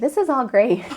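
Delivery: put-on bright, eager gush of a young newcomer; loud, high, starry-eyed excitement; naive enthusiasm. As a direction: gush it like you're young and loving everything. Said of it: "I was like".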